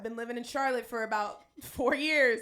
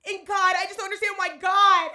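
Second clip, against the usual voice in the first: higher, louder mock sob to God; hammed-up tearful lament; mock wailing cry; 7 semitones higher, 11 dB louder than usual. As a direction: ham up the heartbroken wail. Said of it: "And then you love to say".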